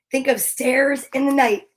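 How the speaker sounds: usual speaking voice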